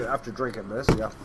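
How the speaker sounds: usual speaking voice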